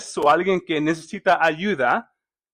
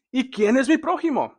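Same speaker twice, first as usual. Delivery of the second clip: agudo, desafiante, justificándose; pregunta del intérprete; 7 semitones higher, faster than usual. Acted. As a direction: pregunta alta como quien busca excusa. Voz del intérprete de la ley. (el intérprete de la ley pregunta a Jesús, con tono agudo y retador)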